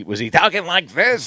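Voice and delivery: put-on comedian's stage voice; Gilbert Gottfried. Wondering how the comic spoke at home, in his imitated stage voice. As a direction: imitate a comic who never drops the act. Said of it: "his is more like an act".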